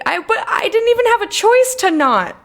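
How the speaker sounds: loud complaint climbing high; exasperated protest. Real, not acted. Protests forced choice in game.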